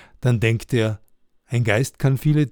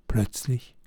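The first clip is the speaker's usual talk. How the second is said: usual speaking voice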